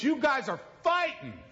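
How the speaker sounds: high little-boy voice, a touch mock; child's voice. Preacher voicing his young son noticing the fight, high childlike. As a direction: imitate the small son catching his parents.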